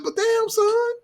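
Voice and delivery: high, airy voice for her compliment; imitating her. Girl teasing him after, high mock voice. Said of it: "She was like".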